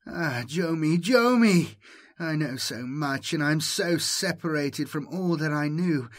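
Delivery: the ancient mind moaning to Jomi. Acted. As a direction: ache with longing as you call him. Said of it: "the voice's moaning words".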